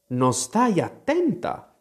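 high, drawn-out stern scolding; severo. A narrator's reading. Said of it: "disse il topo ad Alice severo". (rimprovero severo)

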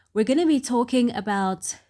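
ordinary delivery

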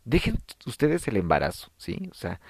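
ordinary delivery

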